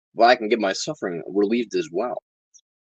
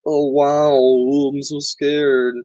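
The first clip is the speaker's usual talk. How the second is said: higher, louder, slow mock terror; sarcastic fake fear; 7 semitones higher, 5 dB louder, much slower than usual. Mocking the man with the finger gun, sarcastic fake fear. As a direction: drip sarcasm, pretend to be frightened. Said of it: "I'm like".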